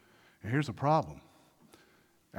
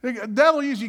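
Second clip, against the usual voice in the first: loud, raised preacher's call; preaching at full force; 8 semitones higher, 8 dB louder than usual. Acted. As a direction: drive it home to the church.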